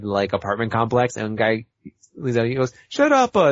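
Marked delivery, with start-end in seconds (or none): 2.9-3.5 high, loud mock Italian accent; imitated Italian yell. Guy leaning out in a retold cartoon, mock Italian yell. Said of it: "like, Italian neighborhood".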